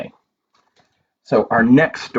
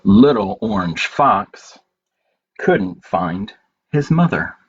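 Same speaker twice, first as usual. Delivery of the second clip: gentle, unhurried picture-book reading with long pauses; reading to children; slower than usual. Acted. Reading A Good Day to children at story time, gentle and slow. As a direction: read it slowly to little ones, letting each page land.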